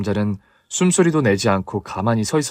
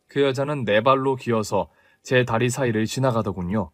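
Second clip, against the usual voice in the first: ordinary delivery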